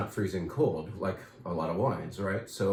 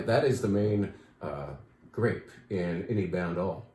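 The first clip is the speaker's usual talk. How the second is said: ordinary talk, nothing marked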